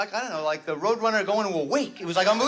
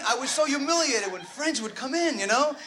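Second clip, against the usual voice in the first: usual speaking voice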